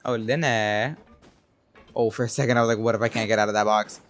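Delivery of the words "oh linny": ordinary talk, nothing marked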